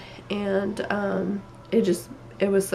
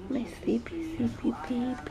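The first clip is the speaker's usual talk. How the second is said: soft, high cooing; 5 semitones higher, 8 dB quieter than usual. Real, not acted. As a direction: coo it tenderly, much softer and higher. Cooing to her newborn.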